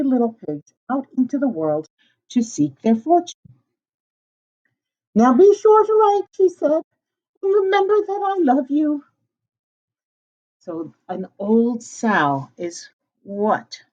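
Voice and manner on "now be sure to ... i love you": high, loud motherly send-off; high sow mother voice; mother pig voice. Acted. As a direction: call after your children as a doting mother pig. The mother sow says goodbye.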